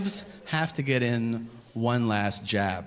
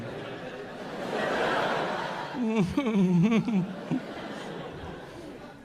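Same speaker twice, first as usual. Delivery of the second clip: put-on 1 giggling toddler; 10 semitones higher than usual. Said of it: "feel like a fucking toddler waiting".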